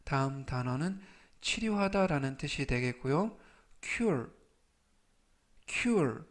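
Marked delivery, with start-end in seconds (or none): 3.8-6.2 cure said as "kyur"; pronunciation demo. Shown as a demonstration. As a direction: model cure for learners to repeat. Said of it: "cure이라고 발음하시면 되겠습니다". (발음 시범)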